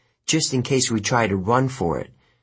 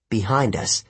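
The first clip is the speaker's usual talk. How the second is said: ordinary delivery, nothing marked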